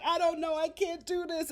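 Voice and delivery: high mock distress; imitating breakdown. Host acting Paige breaking down, high mock distress. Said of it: "Paige has a breakdown".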